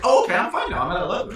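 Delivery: high, bright sarcastic relief; mock relief. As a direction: play it falsely cheerful. Mocking a healed player.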